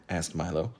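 ordinary delivery, nothing marked

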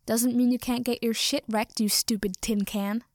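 usual speaking voice

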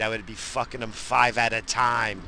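ordinary talk

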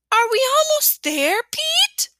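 high, eager little brother pleading; a small boy begging to go back; little kid voice. A narrator's reading. Fudge voiced by narrator.

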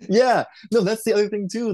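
high-pitched reply out of a laugh; amused agreement. Real, not acted. Still amused right after cracking up.

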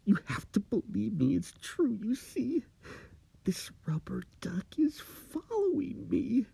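high, pleading whisper rising in panic; high frantic whisper; desperate plea. Acted. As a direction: beg your friend to believe you. Steve begs, terrified.

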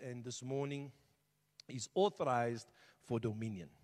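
ordinary talk, nothing marked

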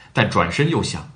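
ordinary delivery, nothing marked

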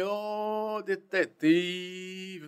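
put-on slow drawn-out call in a Northeastern Brazilian accent. General on the phone greeting the detective, Northeastern accent. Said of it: "Aquele sotaque de nordestino".

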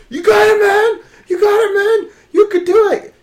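loud sharp voice; yelling spotter. Retold or imitated. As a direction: yell it like a hyped-up spotter. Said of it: "there's no need to yell".

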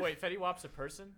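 normal talking voice

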